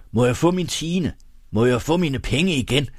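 angry demand. A narrator's reading. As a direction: press indignantly for what is owed.

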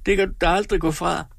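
a hint of weeping woman; crying. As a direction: insist through mock tears. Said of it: "nu græd Tripstine".

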